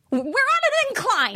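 high, slow, drawn-out cry; mock scolding yell. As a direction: imitate an exasperated mom chiding a crowd. Retold scolding of shoving crowd.

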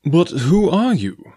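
slow, high-pitched insistent question. A narrator's reading. Clayton presses the stranger for his identity, insistently. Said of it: "insisted Clayton".

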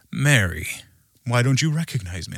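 subdued, judging reproach; mildly disappointed, judgmental reading; Jesus. Acted. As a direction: deliver it as a solemn scolding, without any play. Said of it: "almost like judging her".

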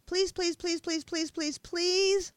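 high, loud begging please; desperate pleading. A narrator's reading. Crying girl pleading at night for a new life, desperate begging. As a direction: beg with all your heart.

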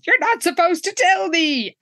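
high-pitched mock yell; retold yell. Jessica yells at Cheryl during the test, mock outrage. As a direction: imitate an indignant outburst, voice jumping up. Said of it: "Jessica yells".

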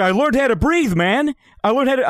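high, loud mock euphoria; excited imitation. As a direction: insist on the amazing discovery, mimicking the roommate. Repeats roommate's euphoric announcement.

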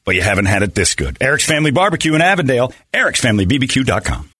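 upbeat radio ad read. Acted. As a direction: sell it like a radio spot. Host reads barbecue ad.

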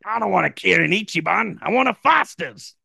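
high, indignant cartoon Aussie; mock Australian. Drinker rejecting Japanese beer, hammed-up Australian. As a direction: refuse it in broad Australian. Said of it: "people from Australia".

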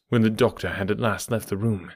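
ordinary delivery, nothing marked

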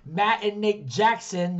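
"Matt and Nick Jackson" said slowly, each name spelled out clearly; name corrected slowly. Shown as a demonstration. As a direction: stress "Jackson" hard, drawn out with a falling end. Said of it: "I don't say, like".

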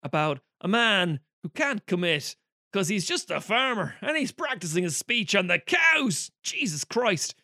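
high, sneering mockery of a cheesy Irish film; sarcastic mock voice of the film. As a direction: roll your eyes at the clichés as you say it. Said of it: "the film was like".

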